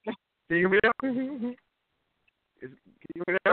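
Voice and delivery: windy, breaking-up phone line; like calling from a plane in wind; bad phone line. Real, not acted. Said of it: "are you jumping out of an airplane".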